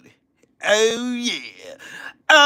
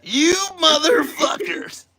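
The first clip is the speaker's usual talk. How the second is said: mock angry yell; 10 semitones higher, slower than usual. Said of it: "why she be like".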